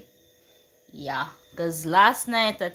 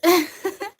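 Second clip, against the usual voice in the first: laugh; 12 semitones higher, 8 dB louder than usual. Real, not acted. Laughing at own crying.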